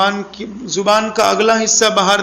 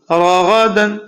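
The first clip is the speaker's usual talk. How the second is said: راغدن said fast and joined; read quickly; much slower than usual. Shown as a demonstration. Quran teacher to pupils, quicker joined reading. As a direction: run راغدن together quickly. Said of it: "لیکن اس کو جلدی پڑھیے".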